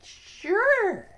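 high, loud, eager cub; Little Theo Lion Cub. Acted. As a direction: agree brightly as a little cub. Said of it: "said little Theo Lion Cub".

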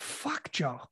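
a breathless whispered reaction; stunned whisper. Retold or imitated. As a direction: whisper it in shock. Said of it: "I'm like".

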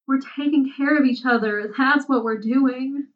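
choked, tearful. A narrator's reading. Zira pleading with Crowley as new tears stream, choked up. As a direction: insist through rising upset. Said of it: "Zira choked".

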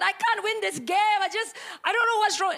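high, frustrated wail; childish screaming protest. Retold or imitated. Eight-year-old answering mom about losing the game, high frustrated protest. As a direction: play the upset kid pleading back. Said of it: "why are you screaming".

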